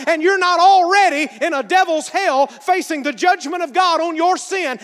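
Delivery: preaching at full force. Real, not acted. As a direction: build the sermon to a big crescendo.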